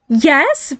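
high, irritated yes; irritable. Acted. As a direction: affirm it testily, pitch jumping up. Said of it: "Barry affirmed irritably".

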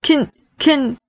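high, halting stammer; stuttering. Acted. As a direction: stumble on the first word and restart. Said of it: "Nick stuttered".

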